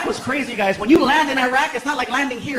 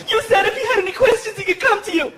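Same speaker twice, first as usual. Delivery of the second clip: higher, fast, indignant mother voice; protective mom; 10 semitones higher than usual. Retold or imitated. Girlfriend defending her son, higher fast indignant voice. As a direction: defend the baby like a mama lion.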